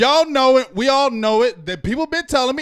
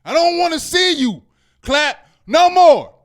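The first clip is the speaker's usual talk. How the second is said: loud, higher angry shouting at the coach; angry rant; 5 semitones higher, 3 dB louder than usual. Real, not acted. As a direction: let the frustration boil over at him. Ranting at coach Jason Garrett.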